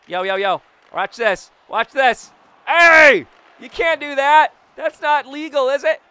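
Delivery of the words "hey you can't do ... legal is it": high, loud cry of objection; indignant protest. Real, not acted. Player objecting to an illegal move, indignant shout. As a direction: shout a protest, pitched well up.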